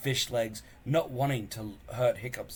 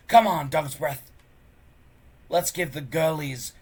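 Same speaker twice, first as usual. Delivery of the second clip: bully's threat; 4 semitones higher, 5 dB louder than usual. Acted. Snotlout threatening Hiccup.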